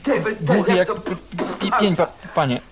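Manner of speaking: trips over every word, repeating first syllables; stammering jailer. Acted. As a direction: stutter hard, start each word several times. (jąkający się strażnik, powtarza pierwsze sylaby)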